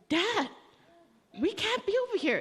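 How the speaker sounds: put-on high, fast, frightened little girl; little girl begging Dad not to drive past the fence; scared child. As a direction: squeak it out like a panicked five-year-old. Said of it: "I'm about to cry 'cause I'm scared".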